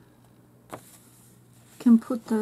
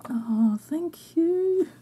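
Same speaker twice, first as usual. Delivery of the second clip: delighted thanks; 7 semitones higher, 4 dB louder, much slower than usual. Real, not acted. Thanking gift sender.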